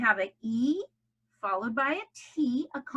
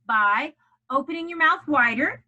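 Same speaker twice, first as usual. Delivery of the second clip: louder, opened-up stress landing on "mouth wider"; accented delivery; 4 semitones higher, 8 dB louder than usual. Shown as a demonstration. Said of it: "accent means putting stress".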